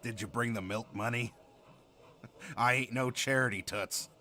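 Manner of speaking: low raspy smoker's voice; smoker's rasp. Acted. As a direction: gravel it out like a chain smoker. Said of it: "You're in love with the smoker's voice".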